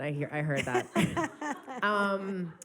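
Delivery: ordinary talk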